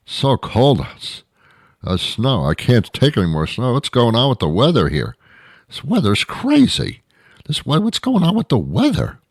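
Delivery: high-pitched hammed-up complaining; imitating a weather whiner at wits' end; mock whining. Weather complainer's griping builds to hysteria.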